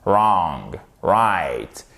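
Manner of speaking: "wrong" starting straight on the R; silent W. Shown as a demonstration. Teacher showing how it is pronounced, silent W. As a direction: say "wrong", no W sound, then "right". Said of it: "mais on prononce wrong".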